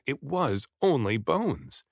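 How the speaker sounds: ordinary delivery, nothing marked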